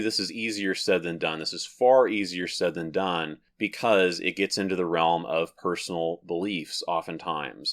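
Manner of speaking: ordinary talk, nothing marked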